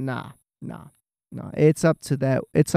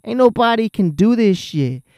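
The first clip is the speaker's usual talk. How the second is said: cocky trash talk; 6 semitones higher, 4 dB louder than usual. Pretend. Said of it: "I'd be talking all the mad shit".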